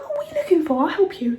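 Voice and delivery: overly keen, pushy offer of help; mock shop assistant. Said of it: "And he was like".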